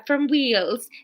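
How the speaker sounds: warm-up phrase in Welsh accent; lilting Welsh vowels. Shown as a demonstration. Narrator resets for Fiona's line, Welsh accent.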